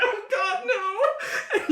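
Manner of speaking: high, wailing acted cry; screaming in horror. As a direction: wail like the maimed victim. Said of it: "he's just screaming now".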